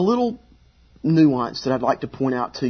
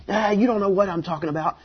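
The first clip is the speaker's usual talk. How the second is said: higher, quick brush-off; mock dismissive; 5 semitones higher, faster than usual. Imagined Jesus putting a doubter down, mock dismissive. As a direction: play a scornful put-down, fast. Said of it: "crushing him and smacking him around".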